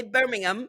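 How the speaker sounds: "Birmingham" repeated as "BIR-ming-um"; corrected British pronunciation. Shown as a demonstration. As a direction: try "Birmingham" with the h dropped and a weak "um". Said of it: "The second one was spot on".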